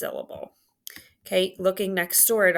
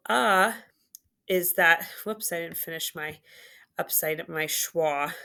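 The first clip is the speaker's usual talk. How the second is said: normal talking voice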